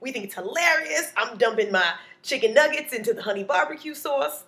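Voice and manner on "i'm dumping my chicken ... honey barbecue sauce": ordinary delivery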